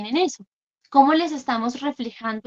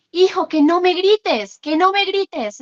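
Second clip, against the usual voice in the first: raised, slow, exasperated scolding; yelling at child; 6 semitones higher, 7 dB louder, slower than usual. Retold or imitated. Fed-up parent yelling at her son to stop yelling. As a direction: shout it the way a fed-up parent does. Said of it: "si yo se lo digo gritando".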